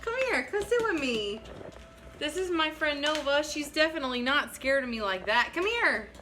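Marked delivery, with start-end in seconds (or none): 0.0-1.4 calling a dog. Real, not acted. 5.5-6.1 high, sweet pet-calling voice; coaxing a pet. Real, not acted. Coaxing the dog to come.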